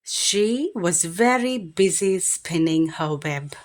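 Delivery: usual speaking voice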